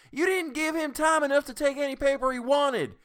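high, loud angry shout. A narrator's reading. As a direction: shout the protest at full voice. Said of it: "Tessie Hutchinson shouted".

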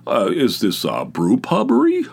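lightly voiced mock snob; mocking fussy commenter. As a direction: send up the pedant with a lifted voice.

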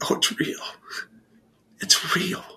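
hushed, breathless whisper of disbelief; awed whisper. Retold or imitated. Recalling his mother's conversion, an awed whisper. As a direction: breathe it out like you can't believe it. Awed reaction to mom's news.

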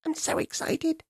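whisper-chatter like an eager tiny monkey; quiet excited monkey. Acted. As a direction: monkeys following the king, quiet and thrilled. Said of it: "chattering quietly".

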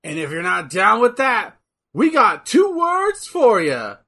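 building to a big shout; wrestling promo shout. Pretend. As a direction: hype up the crowd, yelling. DX catchphrase shout.

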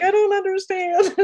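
hammed-up tearful whine; mock sob. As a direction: wail it like an overwhelmed preteen. Mimicking herself crying at dad.

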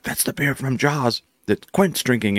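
slow, lightly voiced Quint quote; slow nod to the Jaws captain; Quint line. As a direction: ease into a gruff sea captain, only a hint. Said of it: "he does the Quint line".

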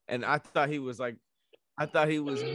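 usual speaking voice

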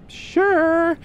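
very high, chirpy woman's reply; sunny springtime voice. Acted. Rita replies to the mayor's summons, sunny springtimey voice. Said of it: "springtimey voice".

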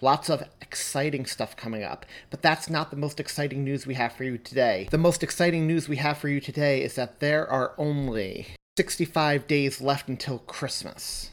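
ordinary talk, nothing marked

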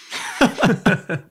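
a real, high burst of laughter; a bright laugh after the punchline. Real, not acted. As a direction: laugh at your own story. Laughs after telling first date.

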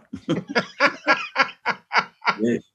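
high-pitched run of laughter; laughing. Real, not acted. Laughing about being cut down.

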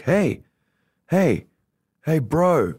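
acted insistent call to someone leaving; imitated calling out. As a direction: try to catch a stranger's attention. Stranger calls after them leaving club.

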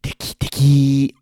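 imitating learners. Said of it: "People will say".

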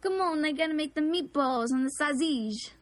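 Italian-American caricature. Acted. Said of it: "stereotypical, like, Italian".

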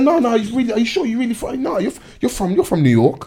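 high-pitched, stumbling incredulity; mock disbelief. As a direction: stammer it like a fan who can't accept it. Acts a disbelieving fan's questioning.

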